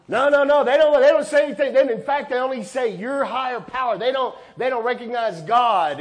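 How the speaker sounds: high, loud, fast mock protest from a churchgoer; indignant objection. Pastor voicing a churchgoer who objects, high and loud indignation. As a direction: bluster it like an offended church member.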